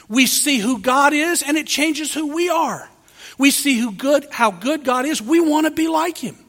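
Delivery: ordinary talk, nothing marked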